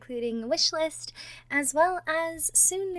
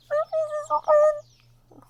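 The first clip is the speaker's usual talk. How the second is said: breathless giddy squeak; giddy flustered; 6 dB louder than usual. Acted. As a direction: gush over blushing. Flustered after the call.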